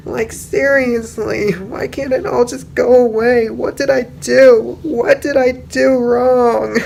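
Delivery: high, strained voice breaking into sobs; a desperate pony pleading to Celestia, near tears; distraught Rainbow Dash. Retold or imitated. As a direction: Rainbow Dash cracking under nightmares, high and tearful. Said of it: "and she is just like".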